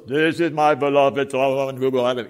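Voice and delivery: a voice from the cloud speaking in Hollywood style; movie God voice. Retold or imitated. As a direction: boom it out like God in a film. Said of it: "that's how God talks in all the movies".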